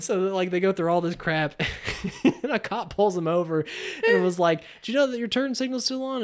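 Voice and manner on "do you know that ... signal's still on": high-pitched mock cop query; lightly voiced police officer, pitched up; imitated cop. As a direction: play a helpful traffic cop. Acts cop pulling them over.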